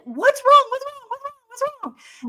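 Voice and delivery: high, quick repeated worried asking; husband asking what's wrong, high and anxious. Retold or imitated. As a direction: fuss over her like a panicked husband. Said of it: "he's like".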